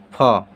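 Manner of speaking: फ said low and clear; letter sound demo. Shown as a demonstration. Teacher modelling फ for students, low and clear. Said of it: "इसका उच्चारण हो जाएगा".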